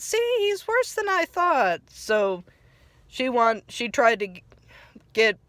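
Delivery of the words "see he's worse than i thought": a concerned friend, pitched up; Sandy imitated.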